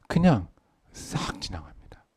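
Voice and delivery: slow, low hushed whisper; whispered payoff. Acted. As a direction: let it slip away in a whisper. Whispered punchline. (낮고 느린 속삭임)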